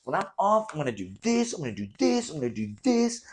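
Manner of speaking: playing his own overexcited plans; hyped-up self quote. Telling viewers his day-off plans, mock hyped voice. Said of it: "I'm like".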